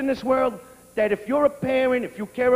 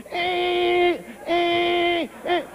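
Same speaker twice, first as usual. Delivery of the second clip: nasal creaky squeak like a broken door; squeaky violin imitation; 6 semitones higher, much slower than usual. Comic imitating an old violinist's squeaky playing. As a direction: mimic a scraping violin. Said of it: "squeak like a broken door".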